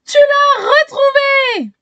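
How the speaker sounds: high, slow happy shout; delighted shout. A narrator's reading. Easy Pipic thanks the girl with a happy shout. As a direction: shout it out with pure joy. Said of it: "crie Easy Pipic".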